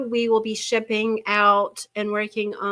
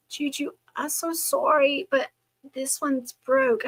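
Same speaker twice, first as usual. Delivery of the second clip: higher-pitched imitation of a worried little girl; imitated apologetic grandchild; 5 semitones higher than usual. Granddaughter confessing to grandmother, apologetic child imitation. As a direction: lightly voice a child owning up. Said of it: "she thought she was gonna get in trouble".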